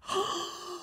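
high, drawn-in gasp of mock delight; exaggerated thrilled intake of breath; excited gasp. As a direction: gasp as if spotting a treasure. Acts joy at seeing parking space.

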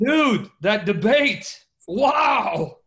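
loud, high, drawn-out amazement; amazed exclamation. Real, not acted. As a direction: stretch each word in big disbelief. Astonished reaction to the debate.

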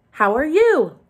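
high, loud puppet greeting; silly puppet voice. Shown as a demonstration. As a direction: pop it out like a clumsy puppet. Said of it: "close it to talk like that".

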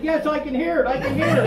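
high, loud imitation of an excited woman; mock-thrilled squeal of belief, played for laughs; excited tourist. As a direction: exclaim it way up high, hammed-up. Excited tourist voiced in a story.

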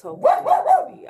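high, loud squeaky hope; hopeful squeak. Real, not acted. Vlogger hoping her filming goes well, in a high squeak. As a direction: squeak it out, half joking.

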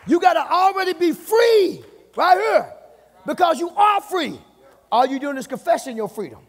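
high, loud, shouted preaching, slowing on the key lines; preaching at full force. Acted. Pastor urging the church to get free, shouting preaching. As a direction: drive it home to the congregation like a revival.

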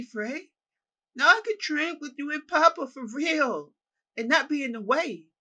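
put-on high, loud, eager little-boy voice; excited child calling to his brother; childlike. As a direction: gush like an excited child reunited with family. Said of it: "he says in a childlike manner".

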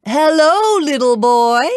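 loud, high giantess greeting. A narrator's reading. Giant woman greets Jack, loudly. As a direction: boom out a hello, pitched high. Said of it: "said in a loud voice".

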